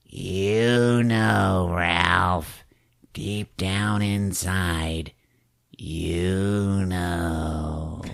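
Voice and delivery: soft, slow, eerie murmur; creepy doll voice. Retold or imitated. Mister Punch talking to Ralph, slow eerie murmur. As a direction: let the doll whisper knowingly. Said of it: "he just goes".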